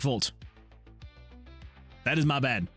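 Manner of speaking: normal talking voice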